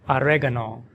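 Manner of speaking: oregano as "a-REG-a-no", stress on reg, short uh in the middle; American oregano. Shown as a demonstration. Teacher demonstrating oregano in American English, stress on reg. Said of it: "with the stress on the second syllable".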